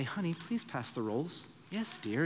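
light, mannered politeness; prim and proper. Retold or imitated. Wife's proper family passing rolls at dinner, softly polite. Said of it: "my wife's family was very proper".

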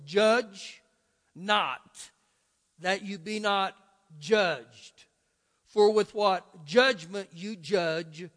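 slow, weighty reading with pauses between phrases; real slow. Shown as a demonstration. Pastor leads the congregation through the verse, real slow. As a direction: read it word by word, leaning on each judge. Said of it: "Read it again with me real slow".

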